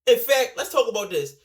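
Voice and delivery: loud no no no, then ranting; emphatic cut-in. Real, not acted. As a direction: stop yourself and launch in loudly. Cuts himself off, starting a rant.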